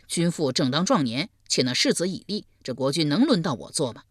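ordinary delivery

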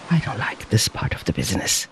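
low, quick, hushed mutter; quiet reluctant aside. A narrator's reading. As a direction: grumble it softly under your breath. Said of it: "he muttered".

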